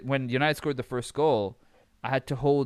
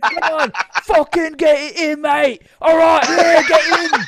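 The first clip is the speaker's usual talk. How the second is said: much higher and louder English cheer, laughing through it; laughing English fan shout; 14 dB louder than usual. Retold or imitated. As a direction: yell at the pitch in an English accent, cracking up. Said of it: "I became English suddenly".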